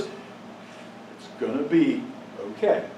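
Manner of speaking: soft, slow, calm reassurance; hushed and tender, slow; gentle reassurance. Retold or imitated. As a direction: say it softly, like comfort from above. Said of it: "And all he said was".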